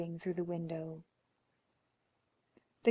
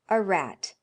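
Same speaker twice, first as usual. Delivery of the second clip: a loud, frightened "A rat"; shaking tones; 8 dB louder than usual. Acted. Old man lying to his wife about the knock, shaky and loud. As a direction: blurt it out, trying to hide the fear. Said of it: "said the old man in shaking tones".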